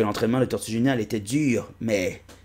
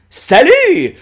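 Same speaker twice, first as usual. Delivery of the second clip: loud, much higher hello; high cartoon greeting; 12 dB louder than usual. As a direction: call out hello in a squeaky voice. Personnage salue fort. (salut aigu et fort)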